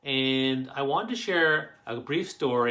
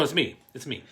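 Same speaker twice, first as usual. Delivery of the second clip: ordinary delivery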